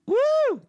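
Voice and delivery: high, loud "woo"; excited whoop. Real, not acted. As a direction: let out a loud, high-pitched cheer. Delighted cheer at screen switch.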